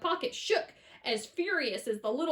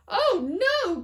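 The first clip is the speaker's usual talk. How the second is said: higher, loud dismayed cry; alarmed cry; 8 semitones higher, 8 dB louder than usual. A narrator's reading. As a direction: gasp it out in horror. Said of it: "cried Missus T V".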